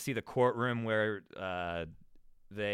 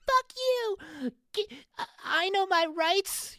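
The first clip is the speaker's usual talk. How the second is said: whiny ranting voice pitched far up; mocking freak-out customer; slower than usual. Mocking a YouTube freak-out customer in a store, high whiny rant. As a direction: imitate a convenience store customer melting down, high and strained. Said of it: "It's like".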